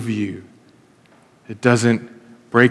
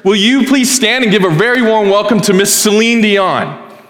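grand announcer; 7 semitones higher than usual. Retold or imitated. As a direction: announce a star to the crowd like an emcee. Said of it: "and he says".

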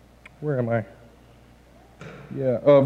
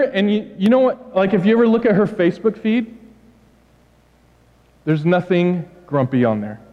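ordinary talk

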